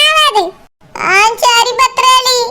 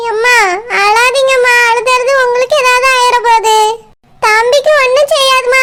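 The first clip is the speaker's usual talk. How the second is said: ordinary delivery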